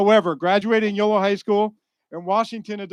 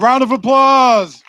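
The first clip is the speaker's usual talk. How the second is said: loud, high, drawn-out shout; loud call for applause; 6 semitones higher, 13 dB louder, slower than usual. Real, not acted. Host rousing the audience to clap, shouted. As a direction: belt it out slowly to the whole room.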